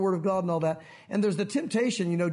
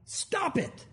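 mock rebuke; 7 semitones higher, much slower than usual. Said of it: "a strong rebuke".

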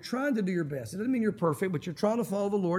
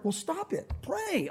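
higher, softer mock reprimand; scolding reply; 7 semitones higher, 5 dB quieter, slower than usual. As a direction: play the Lord telling him off. Voicing the Lord's scolding reply.

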